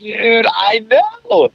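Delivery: high, slow and drawn out; emphatic. Real, not acted. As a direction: stretch it out, amazed. Agreeing emphatically.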